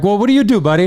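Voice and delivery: high mock condescending question. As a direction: talk down in a high voice.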